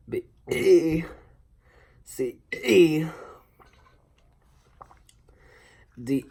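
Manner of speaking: burped letters; talking while burping. Acted. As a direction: burp each letter out. Burping the alphabet.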